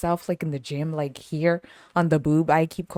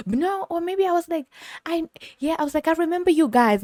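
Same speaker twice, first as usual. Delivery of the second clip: bright greeting; 11 semitones higher than usual. Retold or imitated.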